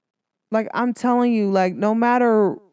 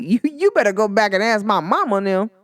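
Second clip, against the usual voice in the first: ordinary talk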